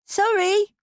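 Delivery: put-on high, small child's apology with a rising end; a little girl mumbling sorry to her cross father; mumbled little girl. A narrator's reading. Daughter apologizes to her irritated father, high child's mumble. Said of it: "mumbled his little daughter".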